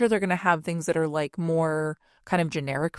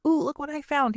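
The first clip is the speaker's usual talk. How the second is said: put-on excited child; 8 semitones higher than usual. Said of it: "it was such, like, a, like".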